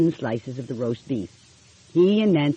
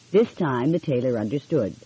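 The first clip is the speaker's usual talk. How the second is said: usual speaking voice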